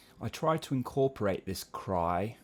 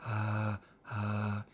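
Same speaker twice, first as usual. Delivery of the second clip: breathy hiss; 3 dB quieter than usual. Shown as a demonstration. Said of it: "They're like".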